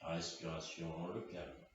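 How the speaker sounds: low, very soft and sparse; hushed closing meditation cues; guided relaxation. Real, not acted. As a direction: lull them into deep rest. Guides final rest.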